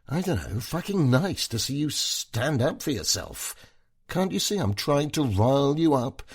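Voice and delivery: high, tipsy taunting; goading wife. Acted. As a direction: needle him, drunk and scornful. Wife goads husband, drunk.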